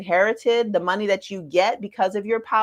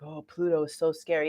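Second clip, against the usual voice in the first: mock scared; 4 semitones lower, 6 dB quieter than usual. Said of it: "people think Pluto and they get a little chill".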